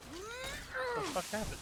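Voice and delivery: sudden shocked squeak; startled squeak. Real, not acted. Sudden startled reaction mid-sentence.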